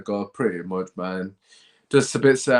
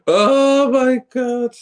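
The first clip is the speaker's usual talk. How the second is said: long drawn-out wail, much higher and louder; anguished wail; 14 semitones higher, 9 dB louder, much slower than usual. Real, not acted. Fan wailing in disbelief at the seven nil loss. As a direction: let out a despairing howl.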